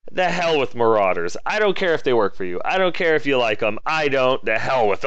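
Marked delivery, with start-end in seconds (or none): none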